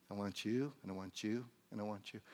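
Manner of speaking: hushed, intimate calling; tender assurance. Acted. Plays God tenderly calling people.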